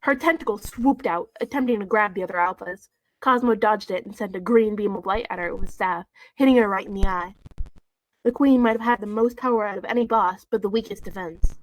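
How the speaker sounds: reading a fanfic aloud to the audience; story reading. Acted. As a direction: read the story straight through.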